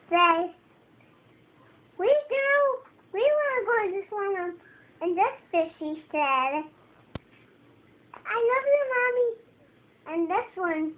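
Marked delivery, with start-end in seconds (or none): 2.0-4.6 lightly voiced high little fishes; high, playful pretend fish voice; little fishes' voice. Child voicing two little fishes. 8.1-9.3 high little fish voice; small, high pretend voice; little fish to Mommy. Child voicing fish to its mommy.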